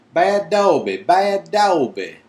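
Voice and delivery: high loud squeal. Acted.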